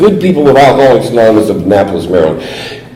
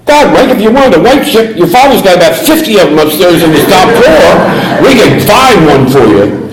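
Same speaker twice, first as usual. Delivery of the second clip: much higher put-on voice of a dismissive uncle; impatient uncle; 8 semitones higher than usual. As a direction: brush off the boy and his chip. Uncle's dismissive reply retold.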